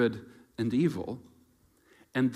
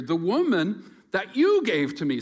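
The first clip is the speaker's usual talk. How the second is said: whiny blaming; 6 semitones higher, 6 dB louder than usual. Acted. Adam shifting blame.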